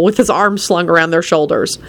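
ordinary delivery

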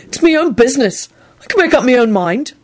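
high, defensive insistence; stubborn. A narrator's reading. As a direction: insist obstinately. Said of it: "Neville responded stubbornly".

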